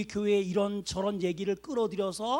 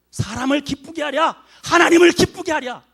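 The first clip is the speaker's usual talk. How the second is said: forceful scripture quote; 6 semitones higher, 14 dB louder than usual. Real, not acted. Pastor quoting the apostle to the congregation, loud declamation. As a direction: proclaim the verse to the church.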